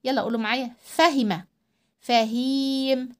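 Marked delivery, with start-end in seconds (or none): 0.8-1.4 quick kasra in فهمة; short i in فهمة. Shown as a demonstration. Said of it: "الكسرة حركة قصيرة". 2.0-3.1 فهيم with a held vowel; long ii in فهيم. Shown as a demonstration. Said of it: "بيطول صوته ازاي".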